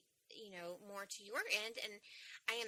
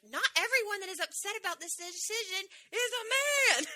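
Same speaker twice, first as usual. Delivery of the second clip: indignant retort; 11 semitones higher, 10 dB louder than usual. Retold or imitated. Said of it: "I was like".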